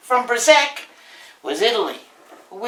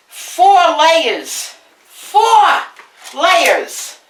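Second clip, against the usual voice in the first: loud, high punch on "four" and "layers"; incredulous emphasis; 6 semitones higher, 7 dB louder, much slower than usual. Retold or imitated. Cook recounting someone's skimpy lasagna, incredulous emphasis. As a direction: hit "four", pause, then "layers", each loud and falling.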